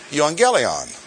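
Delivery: Evangelion as "yoo-ahn-GHEL-ee-on"; Greek pronunciation. Shown as a demonstration. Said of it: "Sounds a little different".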